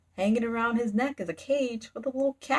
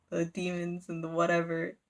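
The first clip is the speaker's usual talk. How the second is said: plain reading voice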